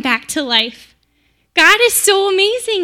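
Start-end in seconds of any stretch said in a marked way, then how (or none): 1.5-2.8 joyful praise. Real, not acted. Mary praising God after Lazarus rises, joyful high exclamation. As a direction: pitch leaps high in wonder.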